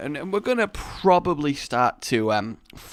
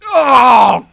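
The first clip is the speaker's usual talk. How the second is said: loud, high cry of pain; acted yelp of agony; mock pain groan; 10 semitones higher, 15 dB louder than usual. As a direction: groan out as if hurt. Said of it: "you just hear, like, pain".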